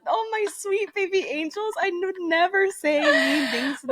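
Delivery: high, sugary voice cooing over her angels; mock doting sweetness. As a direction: coo at them like sweet babies, half laughing. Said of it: "with a very nice and loving tone".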